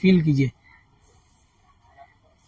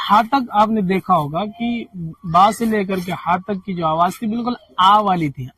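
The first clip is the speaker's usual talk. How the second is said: ordinary delivery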